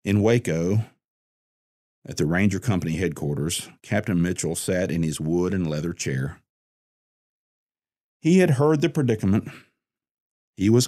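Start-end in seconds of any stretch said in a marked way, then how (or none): none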